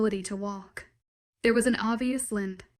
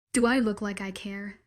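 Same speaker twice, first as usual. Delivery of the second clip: usual speaking voice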